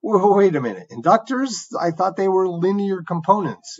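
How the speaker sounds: a mock doubtful student, voice lifted high; puzzled student objection. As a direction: pretend you're a confused student pushing back. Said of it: "you might be saying".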